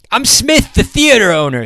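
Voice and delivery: high, loud shriek of terror; terrified shriek. A narrator's reading. Theater owner shrieking in terror at Wild Jack's pistols. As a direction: shriek your name, frozen with fear. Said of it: "shrieked the man".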